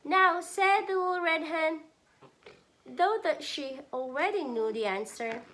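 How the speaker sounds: high, loud question; hen asking knowingly. Acted. Mother voicing the hen's final plea, high and loud. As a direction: call out though she knows the answer.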